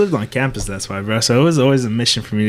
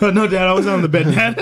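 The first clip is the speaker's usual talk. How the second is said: pleading dog voice; 7 semitones higher than usual. Pretend. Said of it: "voicing the dog".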